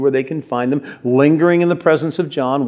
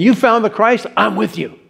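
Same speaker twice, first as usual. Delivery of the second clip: higher-pitched, ready excitement; eager agreement; 7 semitones higher than usual. Acted. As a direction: jump in eagerly, voice lifted. Acts Peter's eager, instant reply.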